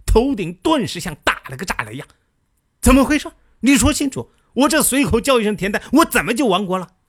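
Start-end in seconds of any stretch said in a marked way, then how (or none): 2.8-6.9 much higher, louder startled demand; jolted king demanding answers at once; alarmed outburst. Retold or imitated. Shaken king demands Diao Bo explain, alarmed and loud.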